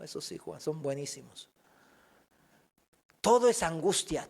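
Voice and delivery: ordinary talk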